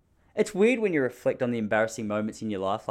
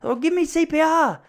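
high, loud mock-panicked wail; panic attack; 5 dB louder than usual. Stung boy asking why he's short of breath, hammed-up panic. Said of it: "You're having a panic attack".

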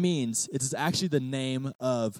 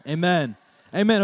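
group amen; 3 semitones higher, 5 dB louder than usual. Real, not acted. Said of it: "everyone said".

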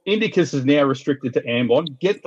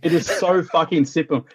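normal talking voice

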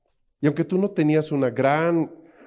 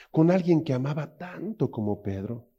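normal talking voice

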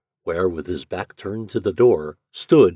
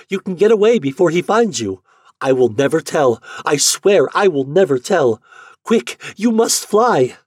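put-on much higher, louder, pleading girl; urgent Yuli; 9 semitones higher, 8 dB louder than usual. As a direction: Yuli begging him to run, rising to a shrill end. Yuli urges David to flee.

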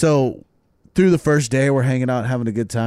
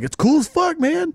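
much higher, amazed; high impressed brother. Retold or imitated. Brother amazed at Mike sharing food, high. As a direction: pitch it up in awe. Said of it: "He's like".

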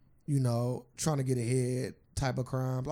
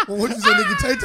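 high burst of laughter, much louder; laughing; 10 dB louder, faster than usual. Real, not acted. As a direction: crack up mid-sentence. Cracks up at friend's joke.

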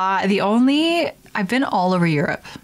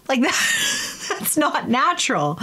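laughing disapproval; 6 semitones higher, slower than usual. Real, not acted. Laughing disapproval of fake teeth.

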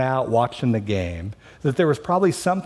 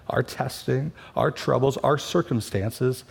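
normal talking voice

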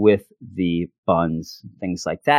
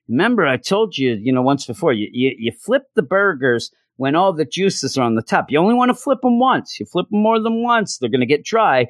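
put-on higher, lecturing old dad voice that climbs well up; fussy stepfather; 6 semitones higher than usual. Stepfather lecturing Owen at the grill, fussy high voice. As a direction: nag him about the burgers, pitch soaring mid-lecture.

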